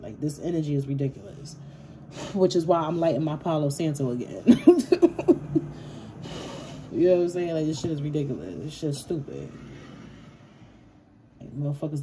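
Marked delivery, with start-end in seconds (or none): 4.5-6.9 real high, nervous burst of laughter; nervous chuckle. Real, not acted. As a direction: chuckle out of nerves, trailing into breath. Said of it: "I chuckle when I'm nervous".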